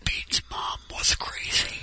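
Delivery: unvoiced whispered aside. Real, not acted. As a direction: whisper it behind his back. Aside joke about mom.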